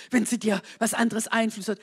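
ordinary talk, nothing marked

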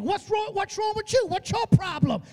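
high-pitched, indignant comeback; retold snapping back. David answers his scolding brother, mock indignant snapping back. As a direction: act out throwing the challenge right back. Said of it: "David snaps back".

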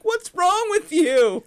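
high, loud, drawn-out disbelief; mock outraged shout. Fan retelling shock at hearing the actor without his accent, mock outraged. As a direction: cry out in hammed-up dismay.

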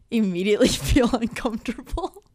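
laughing awkwardly. Real, not acted. Laughing at own awkward story.

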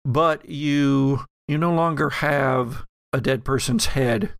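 usual speaking voice

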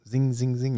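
high-pitched ringing zing, zing, zing; imitated bullet zings. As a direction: mimic rounds pinging off metal. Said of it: "hear the bullets dinging off".

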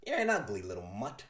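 low Joker sneer at a puppy. Acted. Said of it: "and the Joker says".

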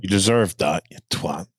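low, dropping to a soft mutter; mock deathbed line. Leaning over dying Boromir, low mock-solemn insult. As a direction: deliver the insult low and quiet, as a joke.

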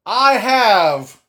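slow, loud build to the name; dramatic reveal. Acted. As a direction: announce it like a ring announcer. Dramatic number one reveal.

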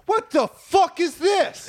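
high, drawn-out indignant yell; mock outraged shout. Homeowner arriving at the fire, outraged. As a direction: shriek it slowly in comic outrage.

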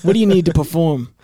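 mock taunt. Inmates taunting the singer.

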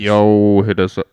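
sudden loud shout into the mic; too loud. Real, not acted. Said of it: "Sorry, too loud, too loud".

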